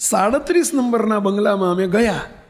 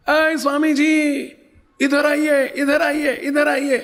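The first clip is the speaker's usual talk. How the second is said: higher, mock call from across the street; old woman calling out; 7 semitones higher, slower than usual. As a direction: call the swami over like an old auntie. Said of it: "એમણે બૂમ પાડી". (માજીની ઊંચા સાદે બૂમ)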